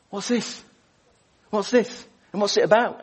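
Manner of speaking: eager questions. Retold or imitated. Latecomer asking about the film.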